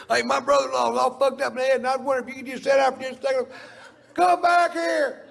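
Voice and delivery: high, loud, fast yelling stranger who slows to a bellow; rude stranger shouting. Retold or imitated. A pushy stranger yelling at Doctor Phil to come back, loud and high. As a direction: bark it at him like a pushy fan. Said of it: "without somebody going".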